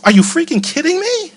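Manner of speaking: high, disbelieving cry; can't believe what he's hearing; incredulous outburst. Retold or imitated. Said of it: "And I was like".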